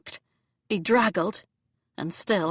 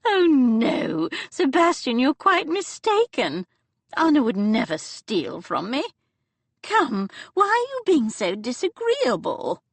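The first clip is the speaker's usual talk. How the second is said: put-on higher, amused old duchess voice; elderly Duchess; 7 semitones higher, 4 dB louder than usual. Aunt teasing Sebastian, amused old lady voice. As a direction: play the dowager, fondly scolding.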